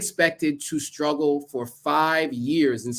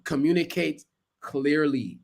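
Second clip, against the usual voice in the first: ordinary talk, nothing marked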